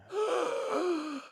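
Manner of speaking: sharp high gasp of shock; surprised gasp. Real, not acted. Shocked look at scoreboard.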